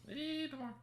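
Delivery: very high squeaky plea; squeaky coaxing. Pretend.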